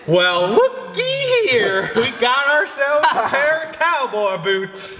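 slow high-pitched Western drawl; howdy-partner cowboy voice. Pretend. Cowboy drawl on finding boots.

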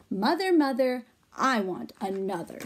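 usual speaking voice

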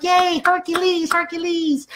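high, slow mock cheer; joking falsetto celebration; cheering client. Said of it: "She's like".

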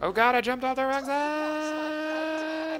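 high, stretched-out startled wail; alarmed cry. Real, not acted. Player yelping after jumping off the wrong side. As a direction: yelp it out long as you slip.

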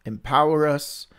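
ordinary talk

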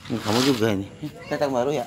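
ordinary delivery

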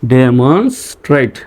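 slow "demonstrate" in separate chunks; teacher's slow sounding-out; syllable by syllable. Shown as a demonstration. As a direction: piece "demonstrate" together for learners. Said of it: "ট্রেট উচ্চারণ হয়".

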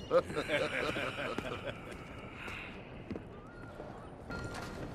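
drawn-out soft laugh; long chuckle. Acted. Dutch laughing off Arthur's worry, long chuckle. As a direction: let a long laugh roll out.

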